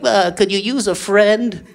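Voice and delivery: slow, hesitant, meek ask; a hint of a shy door-to-door caller; timid religion. Religion knocking with hat in hand, slow and timid. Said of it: "knocking on your door".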